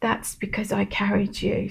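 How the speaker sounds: softly repeating God's reassurance to the viewer; soft tender echo. Acted. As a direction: let the words settle gently, like a comfort.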